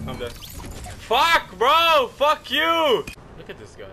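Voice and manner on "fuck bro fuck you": raging scream at the screen; furious yell. Real, not acted. Gamer dying in a fight, screaming in rage. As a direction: explode in fury after getting killed. Player dies in a gunfight, yelling.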